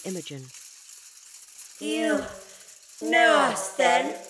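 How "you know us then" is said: higher, louder alien voice that fizzes; fizzing hissing voice. Acted. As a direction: hiss and crackle like live electricity. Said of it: "in a voice that fizzed and hissed".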